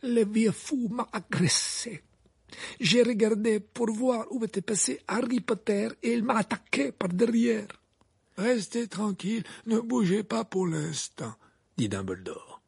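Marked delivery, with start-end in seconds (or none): 0.0-7.7 high, slow, laboured character voice; dazed, aggrieved Krum. Acted. Krum tells Dumbledore he was attacked, dazed and aggrieved. Krum's voice in story. 8.4-11.3 high, measured instruction; steadying wizard voice. Acted. Dumbledore tells injured Krum to lie still, steadying.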